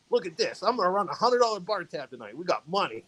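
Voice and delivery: excited quoted boast; imitating a boast. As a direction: play the friend showing off his cash. Retelling friend's bragging about spending.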